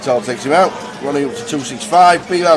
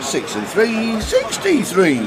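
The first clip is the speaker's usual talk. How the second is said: bingo caller; 7 semitones higher, slower than usual. Pretend. Calling bingo numbers.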